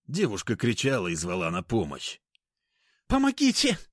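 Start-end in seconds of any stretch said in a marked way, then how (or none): 3.1-3.8 very high, desperate shriek; screaming for help. Acted. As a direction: cry out for help at full pitch. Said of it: "завопила Аня изо всех сил".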